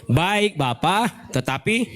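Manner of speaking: slow, sweet drawn-out yes. Retold or imitated. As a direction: stretch it out, sugary. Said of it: "Jawab anak itu apa".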